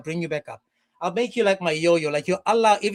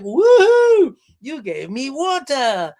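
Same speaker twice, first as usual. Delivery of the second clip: put-on high, loud whoop, then drawn-out baby-talk delight; hammed-up slow, gushing glee; mock delighted whoop; 5 semitones higher, 7 dB louder, much slower than usual. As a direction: cheer as if a toddler did a trick. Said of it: "And he was like".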